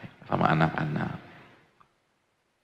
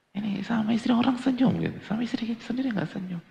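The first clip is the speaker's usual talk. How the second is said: much higher, mocking squeal; 13 semitones higher, 5 dB quieter than usual. Preacher mocking husbands who smile only at others' wives. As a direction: squeal the hypocrisy out.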